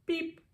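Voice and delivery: high, soft little beep; a tiny toy-truck beep, way up high; truck's beep. Acted. Little truck greets the piggy with a high soft beep. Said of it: "said the friendly Little Blue Truck".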